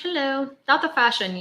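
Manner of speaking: normal talking voice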